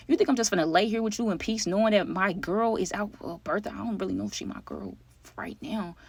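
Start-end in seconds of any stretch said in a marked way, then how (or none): 3.2-5.9 softer, trailing off to a whisper; hushed confession. Real, not acted. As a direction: admit it quietly to the viewers. Unsure aside about girlfriend.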